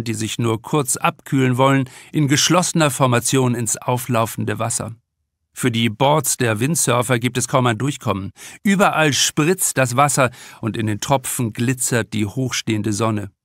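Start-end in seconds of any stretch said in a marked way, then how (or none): none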